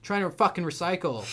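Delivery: loud imitated yelling; mock the angry customer's shout; retold scream. Customer yelling at the clerk, loud imitated scream. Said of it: "He, like, screamed at me".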